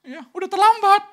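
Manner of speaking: very high, loud exclamation; loud rebuke. Real, not acted. Preacher telling mourners it is too late, loud high rebuke. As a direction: cry it out as a scolding.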